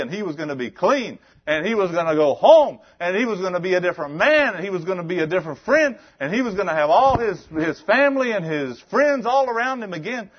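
high, loud, rising preacher's cry; full-force preaching. Real, not acted. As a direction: preach it out, building to a shout.

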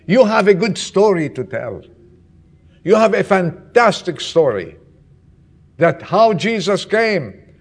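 loud, urgent sermon telling; fervent preaching. Acted. Pastor to congregation, loud fervent preaching. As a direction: preach it out to the whole church.

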